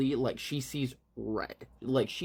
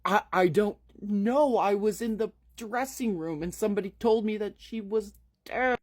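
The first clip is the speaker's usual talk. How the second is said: much higher, shaky with panic; panicked; 9 semitones higher, 3 dB louder than usual. Acted. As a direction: blurt it out in high panic. Lily panicked over Sarah's death.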